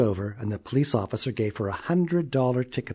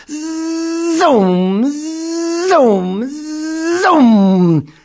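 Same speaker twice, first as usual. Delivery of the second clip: high, loud zooming cries; zooming sound; 8 dB louder, much slower than usual. A narrator's reading. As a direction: belt out each zoom like a racing engine. Child zooming in wheelchair.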